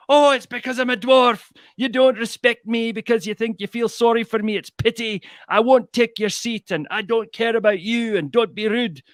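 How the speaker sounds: high, loud raging little man in Scottish voice; comic dwarf in a furious high-pitched huff. Retold or imitated. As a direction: squeak it out in a huffy Scottish rage. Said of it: "the dwarf flew into a rage".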